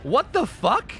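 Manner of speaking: high, loud incredulous outburst; stunned disbelief. Real, not acted. Streamer reacts to bizarre players in the match, real shocked disbelief. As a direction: blurt it out, baffled and shocked. Baffled by weird opponents mid-game.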